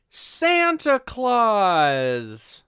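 put-on children mishearing the name, excited shout; kids exclaiming. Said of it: "the kids are like".

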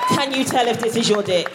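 ordinary talk, nothing marked

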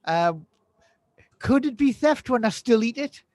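ordinary delivery

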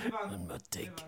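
whisper. Pretend. Joking whispered Clint line.

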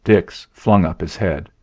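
ordinary delivery, nothing marked

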